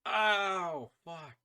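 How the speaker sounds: loud, high yelp of pain; pained cry. Real, not acted. Player yelps at sudden pain, pained cry. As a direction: cry out, then drop off on the curse.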